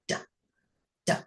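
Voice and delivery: two short "Da, da," beats with a clear pause between; tongue behind the teeth, pop out a lone "Da, da,"; isolated d sound. Shown as a demonstration. Teacher showing how to make the D sound, isolated "Da, da,". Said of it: "you're gonna say".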